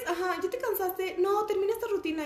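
high, pushy coach-like mom; Bárbara de Regil mom voice. Retold or imitated. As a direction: push her through the workout, high-pitched. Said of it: "imitando a mi mamá un día". (voz aguda de mamá exigente)

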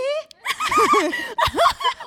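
high squealing laugh of surprise; squealing laugh at a surprise in the snack box; shrieking laugh. Real, not acted. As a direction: let out a delighted shriek of a laugh.

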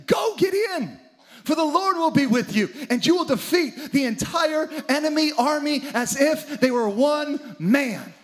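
rally him to battle; angel commanding. Acted. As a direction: angel declaring victory, building to a shout. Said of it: "The angel says to him".

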